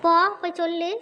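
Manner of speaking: loud, taunting burst; defiant dare. Acted. Sister daring her sibling to tell mother, loud and defiant. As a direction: throw the dare at her, loudly.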